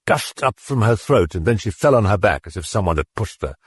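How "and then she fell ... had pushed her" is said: ordinary delivery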